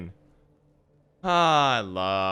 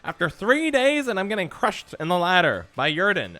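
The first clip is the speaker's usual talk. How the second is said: mocking a whining viewer, higher and louder; whiny complaining fan; 9 semitones higher, 6 dB louder than usual. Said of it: "People are like".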